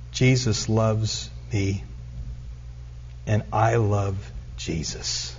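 each word pushed out with effort, gently voiced; strained, slurred. A narrator's reading. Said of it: "in his strained, slurred manner".

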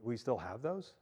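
quiet, baffled mock reply; puzzled quip. Stranger baffled by a shepherd, quiet mock puzzlement. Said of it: "blank stare like".

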